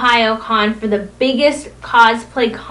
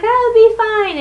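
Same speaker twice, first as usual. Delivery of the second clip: high mock protest; 12 semitones higher, 6 dB louder, slower than usual. Protesting while buried in hoodies.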